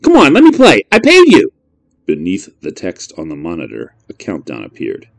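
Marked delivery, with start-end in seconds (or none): 0.0-1.5 high, loud pleading outburst; frustrated kid. Acted. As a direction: beg the machine angrily. Boy pleading with machine.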